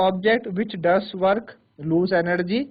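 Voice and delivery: very slow, word by word dictation. Shown as a demonstration. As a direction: spell it out slowly so they can write. Dictating notes to students. (बहुत धीरे, लिखवाते हुए)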